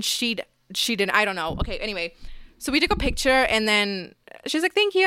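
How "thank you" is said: a much higher, dainty imitated thank-you; sweet thanks. Voicing the seatmate thanking her after the selfie, sweet and high. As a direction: play the shy girl's sweet little thanks.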